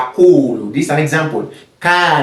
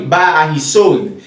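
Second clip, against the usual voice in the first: ordinary talk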